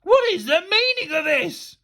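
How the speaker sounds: high, loud indignant bellow. A narrator's reading. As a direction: bellow like an outraged teacher.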